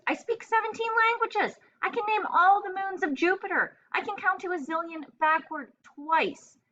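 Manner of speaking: high, offended rant; insulted parrot defending its brains to its owner; indignant outburst. A narrator's reading. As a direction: huff and list your talents in outrage.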